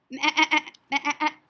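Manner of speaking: loud, high bleating laugh; goat bleat. Acted. Teacher hinting the goat to children, loud high bleat. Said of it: "This animal sounds like a sheep".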